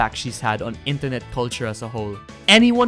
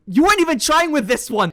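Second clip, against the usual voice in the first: high, fast exclamation; mock outrage; 11 semitones higher, 4 dB louder than usual. Host scolding a producer over a risqué song, mock outrage. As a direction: pitch it way up in comic exasperation.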